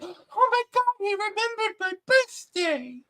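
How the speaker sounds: very high, drawn-out swooning squeal; hammed-up lovestruck falsetto; mock gushing delight. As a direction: imitate her eating it up, thrilled. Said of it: "she eats the fucking shit up".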